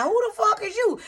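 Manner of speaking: high, stunned "who the fuck is you"; jaw-dropped husband who can't believe his eyes; stunned disbelief. Retold or imitated. Husband seeing his transformed wife, voiced high and stunned. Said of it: "he looking at it like".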